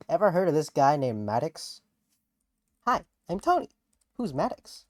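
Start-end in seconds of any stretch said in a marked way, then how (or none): 2.9-3.7 high chirpy character greeting, rising at the end; chipper Tony voice. Acted. Host voicing Tony greeting the audience, high and chirpy. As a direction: pop up bright and high like a cartoon sidekick.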